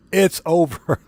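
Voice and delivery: laughing through the words. Real, not acted. Laughing at recalled helicopter crash.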